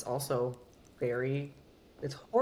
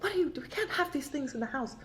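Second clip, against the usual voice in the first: high-pitched mock scolding mother; mother scolding her kid over scary comics; imitating angry mother; 12 semitones higher than usual. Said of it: "my mother was so angry".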